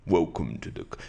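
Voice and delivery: deep, rounded "Welcome to the c-" in a radio host voice; low radio announcer voice; podcast voice. Shown as a demonstration. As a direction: drop into a mock podcast host voice. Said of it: "My daughter said I've got a podcast voice".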